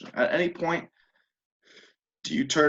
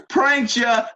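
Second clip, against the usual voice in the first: loud high mocking cry; gleeful taunt; 13 semitones higher, 7 dB louder than usual. Game master repeats the player's taunt, loud and high. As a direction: shout it out like a cheeky kid. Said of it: "You say".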